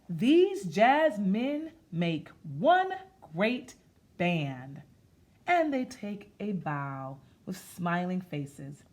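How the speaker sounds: slow, sing-song rhythmic reading; read-aloud rhyme. Acted. Teacher reading a jazz picture book to kids, in a slow sing-song. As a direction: chant it like a nursery rhyme, slow.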